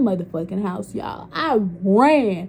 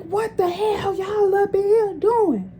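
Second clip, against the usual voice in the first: much higher, slow, scolding auntie voice; the auntie bursting in, appalled; outraged auntie; 11 semitones higher, 3 dB louder, slower than usual. Retold or imitated. Auntie catches them.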